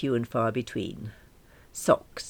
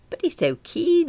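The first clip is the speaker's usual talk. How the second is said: high, louder objection; high protest; 4 dB louder than usual. Acted. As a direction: push back in a fretful high voice. Alice protesting.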